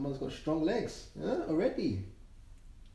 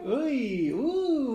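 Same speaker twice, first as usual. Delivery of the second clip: surprised; 6 semitones higher, 5 dB louder than usual. Real, not acted. Playful surprise at baby.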